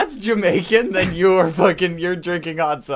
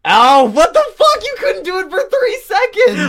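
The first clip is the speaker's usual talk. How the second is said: much louder, high-pitched outburst; loud, incredulous shouting; exasperated yell; 14 semitones higher, 10 dB louder than usual. Real, not acted. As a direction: yell in real disbelief. Outburst when player breaks the rule.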